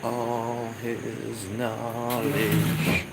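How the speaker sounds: high slow dramatic trailer voice; movie trailer narration. Acted. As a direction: intone it like an epic trailer. Narrates mock trailer over music.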